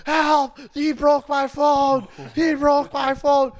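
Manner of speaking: high, drawn-out fake distress call; mock cry for help. Belligerent woman falsely accusing men as cops near, mock cry. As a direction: imitate someone calling out for help, slow and high.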